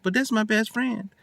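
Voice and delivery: usual speaking voice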